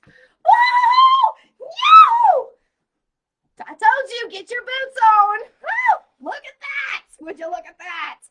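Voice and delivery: high, loud thrilled yells; excited shouting. Real, not acted. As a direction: shout with sheer delight. Ecstatic reaction to card.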